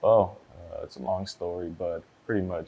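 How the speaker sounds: very soft, under-projected answer; too quiet. Real, not acted. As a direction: answer the court barely audibly. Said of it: "I need you to speak up".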